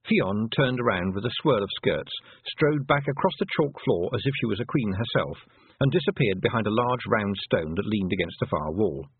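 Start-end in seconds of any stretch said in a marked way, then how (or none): none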